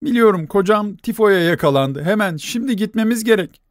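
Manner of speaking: high, frantic urgency; panicked insisting. A narrator's reading. Nelli insisting to the doctor, frantic and high.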